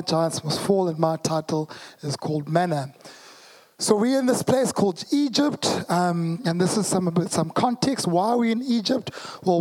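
normal talking voice

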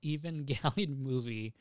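ordinary talk, nothing marked

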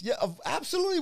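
high, hammed-up eager seller; playful high imitation of the seller; mock eager Bob. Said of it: "He goes, he goes".

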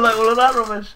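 ordinary talk

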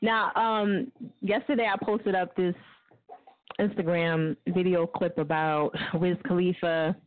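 ordinary delivery

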